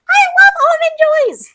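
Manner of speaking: put-on loud, very high kid squeal; eleven-year-old self. Imitating younger self pretending to love candy, squealing eleven-year-old. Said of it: "eleven-year-old me was like".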